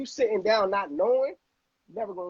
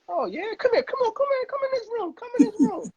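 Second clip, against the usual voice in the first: high, eager loan officer beckoning him in; eager banker. Retold or imitated. Banker eagerly waving him into his office, high excited voice. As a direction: wave him into the room like a keen banker. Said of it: "And it was like".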